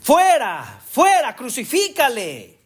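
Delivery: high shouted cry of the crowd. Retold or imitated. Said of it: "Pero ellos gritaron".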